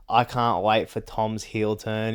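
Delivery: ordinary talk, nothing marked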